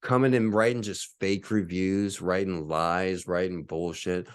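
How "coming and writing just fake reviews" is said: normal talking voice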